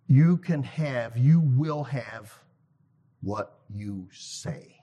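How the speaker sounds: slow, heavy words, fading to almost nothing on "what you say."; said emphatically. Shown as a demonstration. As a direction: lean on each phrase, then drop away to a hush. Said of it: "He said it emphatically".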